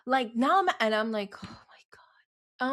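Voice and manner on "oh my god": breathy slow whisper under the breath; whispered exasperation. Retold or imitated. Parent retelling reaction to daughter's questioning, exasperated whisper. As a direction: whisper it drawn out, as a retold reaction.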